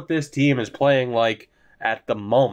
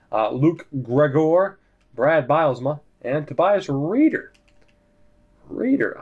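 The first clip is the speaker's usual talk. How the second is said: ordinary talk, nothing marked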